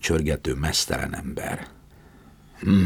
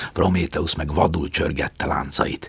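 plain reading voice

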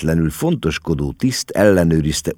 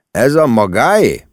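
lightly voiced official, raised and pointed question; a higher, official-sounding query; questioning customs officer; 6 semitones higher, 4 dB louder than usual. A narrator's reading. Customs officer questions mama about the luggage, officious.

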